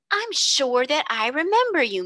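a hint of an eager baker woman recognising someone; bun woman. A narrator's reading. Bun woman voiced in story.

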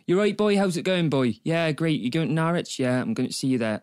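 chatty Norfolk lad greeting a mate; Norfolk accent. Shown as a demonstration. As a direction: do a strong Norfolk local's accent. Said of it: "you do a good Norfolk accent".

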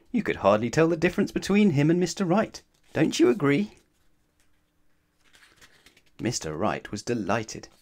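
usual speaking voice

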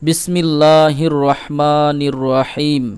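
measured, solemn Arabic opening formulas; Arabic recitation. Acted.

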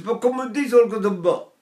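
put-on northern Italian accent. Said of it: "If you go up north".

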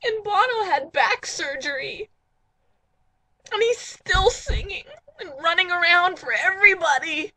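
mock tearful wail. Host telling dates to respect U2, crying wail. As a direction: sob out your admiration.

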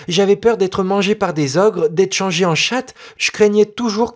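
put-on a spoiled girl explaining away fairy tales; know-it-all girl. Noémie telling the boy tales are for babies, lightly superior girl voice.

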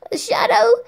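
very high, loud puzzled cry; boy surprised by the word shadow; startled little boy. Acted. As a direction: squeak it in disbelief.

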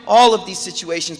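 high, loud impassioned sermon; preaching at full force. Acted. Preacher rousing the congregation, high and loud. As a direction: drive it home to the crowd.

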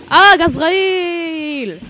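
loud, high, drawn-out yell; long alarmed holler; startled cry. Real, not acted. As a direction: shout it in alarm, stretching the last syllable.

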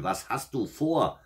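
high, slow, indignant acted outcry; devil's cry. A narrator's reading. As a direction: cry out in startled protest, drawn out. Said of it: "schrie der Teufel".

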